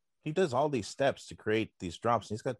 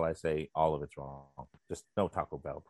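ordinary talk

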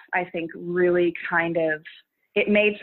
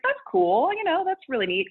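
pitched way up, chirpy retold reaction; high bright quote; 10 semitones higher than usual. Creator recalling her first thousand followers, high delighted. Retells early excitement in quote.

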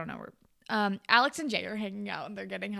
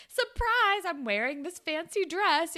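mock excited reveal; 8 semitones higher than usual. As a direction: announce it gleefully, voice shooting up. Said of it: "she's, like, all excited".

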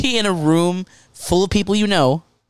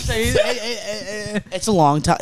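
very slow, stretched insistence; drawn out; 3 semitones higher, much slower than usual. Real, not acted. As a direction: stretch every word out. Drags it out to insist.

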